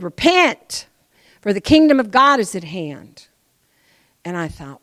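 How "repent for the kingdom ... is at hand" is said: preaching loud. Real, not acted. Preacher quoting John the Baptist, proclaiming loudly.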